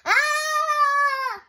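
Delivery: loud high frightened scream. A narrator's reading. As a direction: shriek as you fall. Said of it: "Sam screamed".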